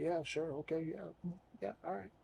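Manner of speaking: distracted brush-off. Half-listening to chatty woman.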